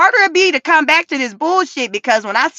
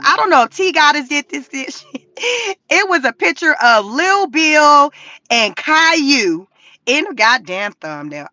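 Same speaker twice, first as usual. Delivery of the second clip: usual speaking voice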